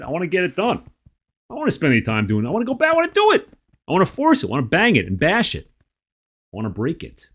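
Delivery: high-pitched exasperated outburst; acted impatient venting. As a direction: vent like someone at the end of their patience. Venting impatience in tapping script.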